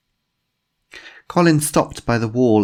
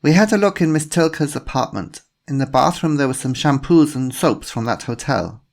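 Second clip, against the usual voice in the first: German policewoman, a touch louder and higher; a polite inspector with a faint German accent; slight German accent; 7 semitones higher, 4 dB louder than usual. A narrator's reading. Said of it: "a woman with a slight German accent".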